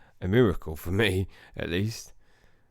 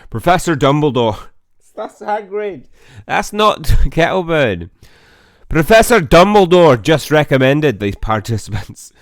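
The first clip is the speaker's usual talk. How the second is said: put-on much higher, much louder Hagrid-style West Country accent; 6 semitones higher, 12 dB louder than usual. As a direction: boom it out like the big friendly groundskeeper. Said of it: "a Hagrid accent".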